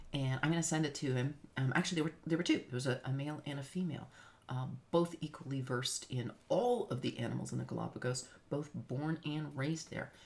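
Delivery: ordinary talk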